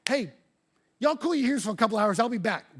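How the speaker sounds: high, loud carefree goodbye; casual brush-off. Retold or imitated. Contact ditching the pastor for a donor, carefree. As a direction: shrug it off and leave. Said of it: "looks at me, and he goes".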